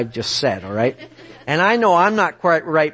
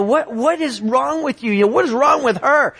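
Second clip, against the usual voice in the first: higher-pitched mock rant at God; retold cussing-out; 7 semitones higher than usual. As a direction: act out telling God off, not fully shouting. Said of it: "I'm cussing God out".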